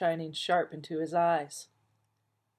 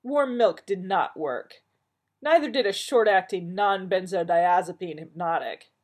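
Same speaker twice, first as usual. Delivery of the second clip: condescending; 3 semitones higher, 7 dB louder than usual. Acted. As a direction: spell it out to an imbecile. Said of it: "explaining in his you're an imbecile voice".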